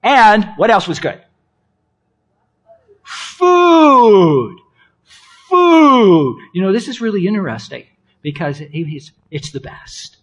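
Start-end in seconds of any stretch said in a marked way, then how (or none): 3.0-6.4 loud high shout sliding down; big playful holler; gleeful cry. Pretend. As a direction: shout it long, letting the pitch fall. Crying out the next good thing.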